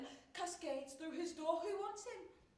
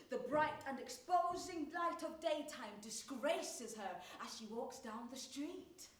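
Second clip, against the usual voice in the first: ordinary talk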